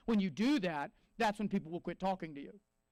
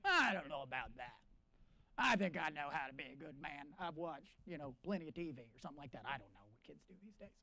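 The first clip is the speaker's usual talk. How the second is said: lower, softer dismissive voice; a mild mock of a careless young man; mocking know-it-all youth; 7 semitones lower, 7 dB quieter than usual. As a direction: brush off the parents like a smug teen.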